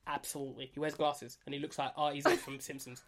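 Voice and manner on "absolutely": lower, softer Mancunian impression; quick mock Mancunian footballer voice; Gary Neville accent. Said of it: "He speaks like that".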